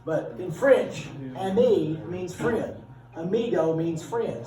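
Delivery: slow, clear teacher delivery; slow explaining. Shown as a demonstration. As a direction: lay it out slowly for the class. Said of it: "teacher spells out word meanings".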